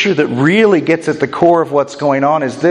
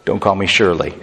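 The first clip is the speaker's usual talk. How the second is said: movie-quote aside; 7 semitones lower, 3 dB quieter than usual. Acted. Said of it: "plagued by the airport movie".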